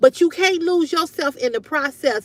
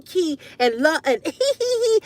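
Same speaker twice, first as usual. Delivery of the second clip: giggly, silly flirting laugh; mock giggle; 3 semitones higher than usual. Mocking a flirty woman with a giggly laugh.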